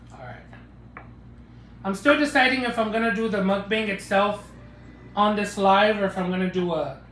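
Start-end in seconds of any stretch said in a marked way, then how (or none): 0.1-1.0 whispered aside. Real, not acted. Quiet aside.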